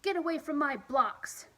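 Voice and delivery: loud high angry yell. Acted. Child yelling at David who knocked over blocks, loud high. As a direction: yell at him to get away. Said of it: "I yelled".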